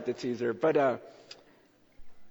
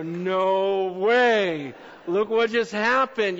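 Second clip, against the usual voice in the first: astonished; 6 semitones higher, 9 dB louder, much slower than usual. Retold or imitated. Astonished at answered prayer.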